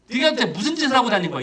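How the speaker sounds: high-pitched mock grilling; accusing interrogation. Father grilling his prodigal son, accusing and high. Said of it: "이렇게 따지는 것도 아니에요".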